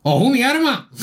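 high, hammed-up Andalusian exclamation; mock Andalusian accent, high and theatrical. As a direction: throw out an exaggerated Andalusian cry. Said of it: "¿Cómo hablan los andaluces?".

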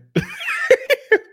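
loud, high burst of laughter; real laugh. Real, not acted. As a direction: crack up at your own quip. Laughs at own mocking acronym.

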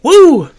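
loud, very high "woo"; high-pitched whoop. Real, not acted. Drinker after a big swig of rum, whooping. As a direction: whoop at the kick of the liquor.

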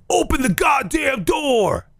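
high, drawn-out mock shout; angry shouting. Stranger outside a hotel room door, imitated angry shout. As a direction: imitate an angry man demanding entry.

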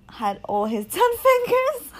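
words break into loud, high laughing; bursting into laughter. Real, not acted. Fan joking about Jason Pierre-Paul's fingers, really laughing. Cracking up at own joke.

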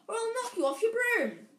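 high, loud shouted threat; calling out. A narrator's reading. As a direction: threaten him at full voice. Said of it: "Harry called".

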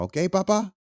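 put-on high Spanish-accented grandma voice; Spanish grandma coaxing her grandson, high voice. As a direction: coax like a Spanish abuela. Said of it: "You know my Spanish grandma".

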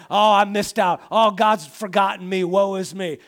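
hammed-up woe-is-me moaning; mock self-pity. Preacher acting out self-pity moments, loud mock whine. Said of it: "I get in these self-pity moments".

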